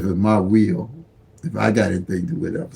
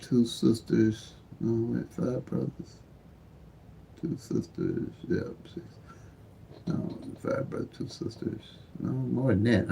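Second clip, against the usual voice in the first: very soft, half-voiced murmur; counting to himself; 13 dB quieter than usual. Real, not acted. Man tallying his brothers and sisters, murmured to himself. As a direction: count under your breath, unsure.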